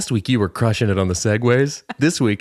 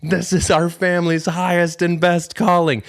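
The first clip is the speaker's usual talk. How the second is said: mock triumphant; 9 semitones higher than usual. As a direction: announce it like a holy revelation. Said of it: "I'd just be over there ... like".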